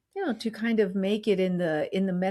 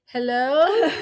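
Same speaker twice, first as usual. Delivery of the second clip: loud, raised hello into an empty house; mock searching call, much louder and higher; 6 semitones higher, 7 dB louder than usual. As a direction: call out to see if anyone is home. Acts calling into empty house.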